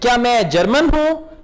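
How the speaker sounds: usual speaking voice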